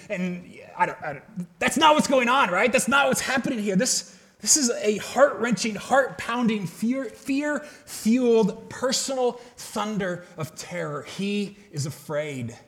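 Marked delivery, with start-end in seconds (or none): none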